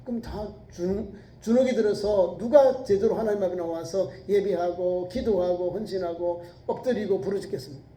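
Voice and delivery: normal talking voice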